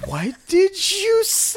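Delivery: high, drawn-out mock disbelief; slow, high-pitched incredulous reaction; retold bafflement. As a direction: stretch it way up high, as if utterly baffled. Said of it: "I was like".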